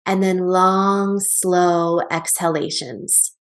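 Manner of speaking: slow, lengthened words, easing off; drawn-out breath cue. Shown as a demonstration. Teacher guiding listeners to breathe out, slow and drawn out. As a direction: let the words flow out like a long exhale.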